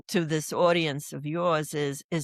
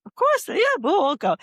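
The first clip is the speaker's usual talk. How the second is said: eager yes; 11 semitones higher, 5 dB louder than usual. Retold or imitated. Eager reply to tour guide.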